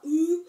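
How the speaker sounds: short, loud mock-creepy cry; half-scary cry. Shown as a demonstration. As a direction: imitate a half-frightening yell. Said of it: "il pousse un cri à moitié effrayant comme ça".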